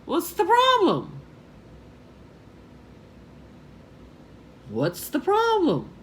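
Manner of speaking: high-pitched, exasperated question; bewildered. Retold or imitated. Tourist asking the worried man, bewildered. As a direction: pitch it way up, pleading for an explanation.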